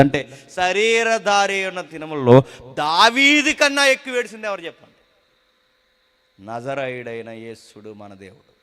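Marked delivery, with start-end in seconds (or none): 0.0-4.9 loud, high, fervent preaching; full-force preaching. Acted. Preacher thundering to the congregation about fear, loud and fervent. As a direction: drive the point home to the congregation.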